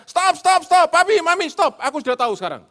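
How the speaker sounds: put-on very high, loud child's yell; mock child screaming at quarrelling parents. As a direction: shout to stop the fight. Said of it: "Anaknya teriak".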